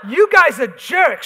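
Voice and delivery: loud, slow furious scream. Acted. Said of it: "she screamed".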